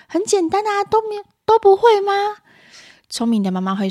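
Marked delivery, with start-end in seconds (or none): 0.1-2.1 very high, exasperated disbelief; irritable mother. Acted. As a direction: act a mum scolding over a simple task. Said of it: "暴躁的妈妈会这样说". (暴躁妈妈尖声质问)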